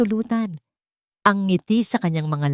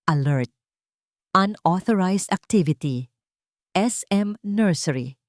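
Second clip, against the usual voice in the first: system alert. Acted. Security system notification.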